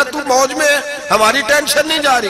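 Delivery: high-pitched pleading question; agitated challenge. Acted. Umar accusing Abu Bakr.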